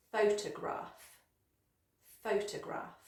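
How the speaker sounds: PHOTOGRAPH as PHO-tuh-graf, weight on PHO; first-syllable stress. Shown as a demonstration. Teacher models word stress, PHOTOGRAPH stressed on PHO. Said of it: "stress on the first syllable".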